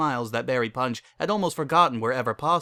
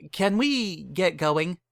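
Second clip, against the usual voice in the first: nervous groom; 6 semitones higher, much slower than usual. A narrator's reading.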